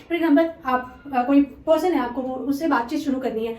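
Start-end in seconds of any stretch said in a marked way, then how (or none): none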